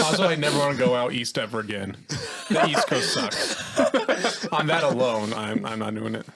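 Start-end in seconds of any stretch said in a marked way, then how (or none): 0.0-4.5 joking complaint broken up by laughter; laughing rant. Real, not acted. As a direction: say it through a laugh.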